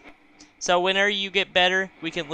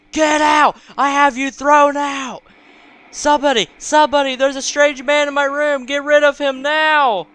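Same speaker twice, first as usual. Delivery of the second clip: high, full-volume screaming for help; loud, high, frantic outburst; panicked yelling; 9 semitones higher, 9 dB louder than usual. Acted. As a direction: yell to have an intruder thrown out. Mother yells for stranger's removal.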